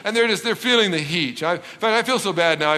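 usual speaking voice

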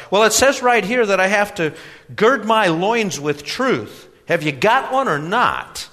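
put-on high, loud, insistent young man; embarrassed young customer. A narrator's reading. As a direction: read out the requirement, high and emphatic.

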